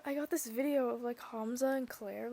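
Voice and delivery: ordinary talk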